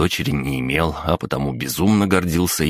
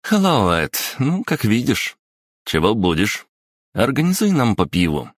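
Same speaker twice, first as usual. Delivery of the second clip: put-on higher, coaxing teenage girl; lively schoolgirl voice; 6 semitones higher than usual. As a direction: cajole a shy classmate. Yulia orders drinks. (бойкая школьница уговаривает)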